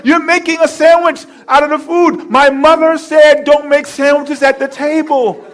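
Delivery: husband citing his mother's table rule. Retold or imitated. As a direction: quote mama's rule at her. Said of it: "I said to her".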